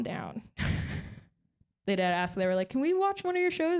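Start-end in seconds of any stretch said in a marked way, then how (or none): 2.7-3.8 high, eager request; mimicked coworkers. Coworkers eagerly asking to watch her shows, pitched up. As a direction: ask it up high like a keen colleague.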